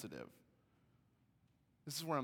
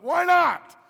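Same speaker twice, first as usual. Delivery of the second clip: very high, very loud challenge; hammed-up shriek of disbelief; incredulous yell; 22 dB louder than usual. Husband demands reason in retold quarrel.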